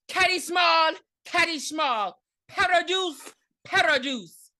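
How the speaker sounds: high, loud, hammed-up incantation; mock spell-casting. Recapper imitates Margot casting the spell, mock incantation. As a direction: call out each phrase in a put-on high voice.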